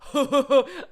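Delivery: loud, high cackling laugh; demonstrating the laugh people mock; high belly laugh. Shown as a demonstration. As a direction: let out a big shrill belly laugh. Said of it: "belly laughs".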